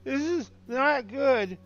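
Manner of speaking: delirious dismay. Acted. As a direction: draw it out high, like a dazed man in trouble. GM voices gutted, delirious man.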